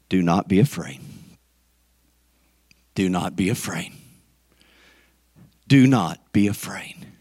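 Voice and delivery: very low, soothing voice; gentle reassurance. Acted. Jesus comforting John alone on Patmos, low reassurance.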